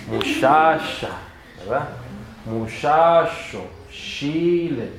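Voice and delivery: "Muchacha", "Muchacho" slow, with a soft sh sound; northern Mexican "sh" in "Muchacha" and "Chile". Shown as a demonstration. Teacher showing his own northern Mexican sh sound. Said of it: "pronunciamos la sh muy suavemente".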